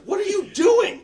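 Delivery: screaming at him over the phone; angry shout. Retold or imitated. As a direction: yell at him furiously.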